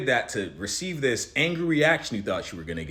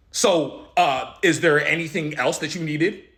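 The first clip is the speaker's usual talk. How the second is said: confrontational question; 4 semitones higher, 8 dB louder, slower than usual. Retold or imitated. Guy challenging him, quoted.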